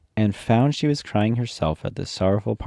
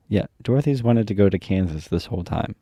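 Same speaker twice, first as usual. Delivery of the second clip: ordinary delivery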